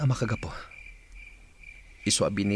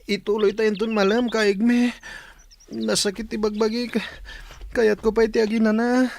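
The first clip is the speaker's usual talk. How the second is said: higher, tight voice of an aching body; strained and sore; 11 semitones higher than usual. Acted. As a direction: let the pain of training pinch the words. Sore after training, asks rest.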